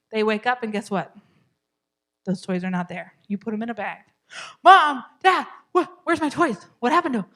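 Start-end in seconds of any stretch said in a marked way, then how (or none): 4.6-7.3 put-on high, loud child's complaint; upset child. Child asking parents where his toys went, high and loud. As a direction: whine it like a kid who lost his toys. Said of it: "child voiced in story".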